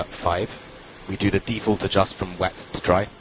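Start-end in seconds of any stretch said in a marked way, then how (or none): none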